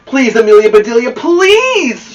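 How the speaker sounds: loud, high desperate plea; pleading shout. Acted. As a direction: beg her at full voice to hit it. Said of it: "shouted the Grizzlies".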